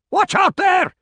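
high, loud cry of warning. A narrator's reading. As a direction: call out a warning at high pitch. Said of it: "cried Grant".

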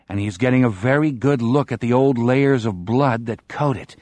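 plain reading voice

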